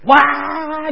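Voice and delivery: long high mock wail; drawn-out, high pretend crying; imitated toddler wail. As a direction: imitate a small child bursting into tears. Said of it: "就哭了".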